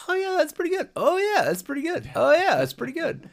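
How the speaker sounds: very high, sing-song approval; pleased rewatch reaction. Retold or imitated. Viewer rewatching favourite episodes, high pleased approval.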